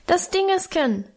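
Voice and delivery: put-on high squeaky little boy's voice; piping child. A narrator's reading. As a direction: squeak it like a toddler. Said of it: "piepste Hermann". (piepsige Kinderstimme)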